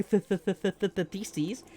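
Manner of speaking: repeated TH, then "theses"; isolated TH sound. Shown as a demonstration. Correcting chat who heard feces, stuttered TH demo into "theses". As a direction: drill the TH over and over before landing "theses". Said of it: "How do you make Theseus sound like feces?".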